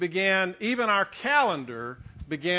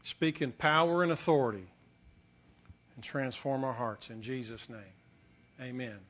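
softly invoke, trailing down to a low amen; prayer; 6 semitones lower, 5 dB quieter than usual. Real, not acted. Preacher asking the Holy Spirit to transform hearts, praying quietly.

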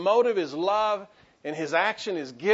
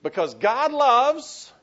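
slow, high and loud prompt; preacher leading a call and response; loud, rising call; 7 semitones higher, 7 dB louder, much slower than usual. Shown as a demonstration. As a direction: call it out for the crowd to answer. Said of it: "say it with me".

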